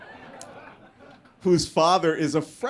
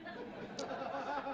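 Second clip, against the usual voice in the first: high airy laugh; 14 dB quieter than usual. Real, not acted. Laugh at joke name.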